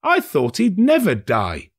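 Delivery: high, loud, heartless quip; a merchant scoffing at the dead; callous remark. A narrator's reading. Merchant sneers.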